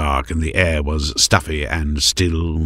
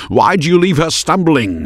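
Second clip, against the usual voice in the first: rebuking; 11 semitones higher, 5 dB louder than usual. A narrator's reading. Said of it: "rebuking the man in tatters".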